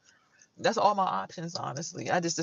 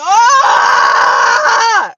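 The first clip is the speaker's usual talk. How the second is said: frightened shriek; 16 dB louder than usual. Retold or imitated. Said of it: "I'm like".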